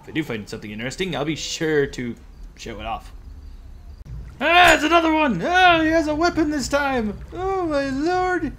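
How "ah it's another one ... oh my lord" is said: high excited alarm; startled, yelping at the enemy. Real, not acted. Enemy appears, alarmed cries.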